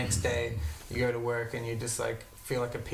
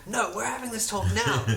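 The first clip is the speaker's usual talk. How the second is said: put-on higher-pitched girlfriend demanding the talk; mock insistence; 9 semitones higher, 4 dB louder than usual. Girlfriend to tired boyfriend at night, mock insistence.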